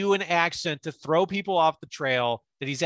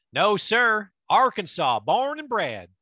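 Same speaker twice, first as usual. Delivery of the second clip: put-on Arkansas accent; 4 semitones higher, 3 dB louder, slower than usual. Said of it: "I would just do the Arkansas accent".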